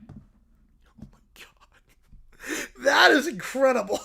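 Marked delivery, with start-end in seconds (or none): 0.1-2.7 breathless whisper, very soft; stunned under-breath; hushed disbelief. Real, not acted. Stunned aside.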